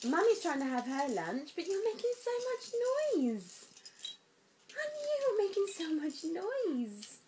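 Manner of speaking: high, singsong mummy voice. Real, not acted. Mum teasing her noisy baby, high baby talk.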